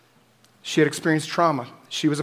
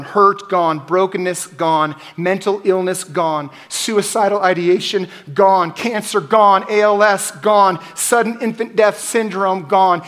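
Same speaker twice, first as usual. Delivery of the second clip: each gone pushed louder and higher; building preaching; 3 semitones higher, 5 dB louder, slower than usual. Acted. Preacher naming what will be gone, rising in force. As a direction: stack them up and build.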